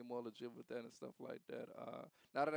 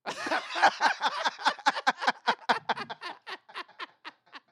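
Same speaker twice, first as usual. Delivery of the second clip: long high loud laughing fit; loud cackle; 17 dB louder than usual. Real, not acted. Friend's joke lands.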